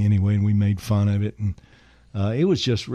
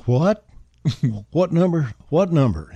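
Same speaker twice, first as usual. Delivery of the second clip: flustered; 6 semitones higher than usual. Retold or imitated. Flustered aide voiced in story.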